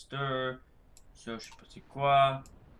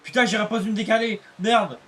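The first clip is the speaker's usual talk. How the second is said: frustrated cursing; 5 semitones higher, 9 dB louder than usual. Real, not acted. Crash in race, cursing.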